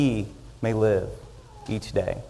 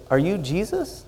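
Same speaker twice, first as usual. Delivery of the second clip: higher-pitched, disbelieving question; incredulous; 6 semitones higher, 5 dB quieter than usual. Acted. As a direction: ask it in mild mock disbelief. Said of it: "a little bit incredulously".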